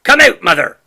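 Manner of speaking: loud urgent shout for his wife. A narrator's reading. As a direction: call her from across the yard. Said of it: "he shouted".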